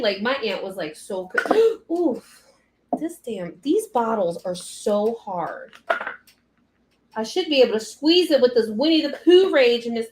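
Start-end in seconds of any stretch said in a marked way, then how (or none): none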